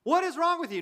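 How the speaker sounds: mock-scolding the chatterers; exasperated outburst. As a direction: fling the question out in disbelief. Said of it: "If you're babbling".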